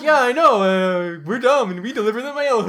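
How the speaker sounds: high put-on dopey voice; hammed-up high-pitched dope; mock dimwit. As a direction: play a dumb mail carrier agreeing cheerfully. Mocking self as dumb mail carrier.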